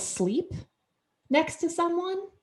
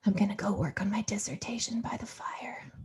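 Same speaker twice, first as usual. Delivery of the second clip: soft intimate whisper; hushed sleepy storyteller; whispered bedtime voice; 9 dB quieter than usual. Retold or imitated. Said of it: "audio erotica recording".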